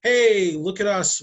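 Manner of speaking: loud, high, pushy ad voice; a hammed-up spammy sales yell; mock shouted spam. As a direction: shout it like an all-caps subject line. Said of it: "all caps and saying".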